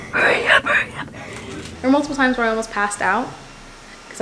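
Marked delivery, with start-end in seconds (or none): 0.1-1.1 urgent whisper. Retold or imitated. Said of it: "I was like".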